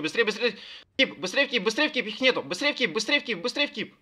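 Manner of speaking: high, urgent repeated commands; frantic hurry-up. Real, not acted. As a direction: hurry them, pitch rising. Raid leader urging everyone on.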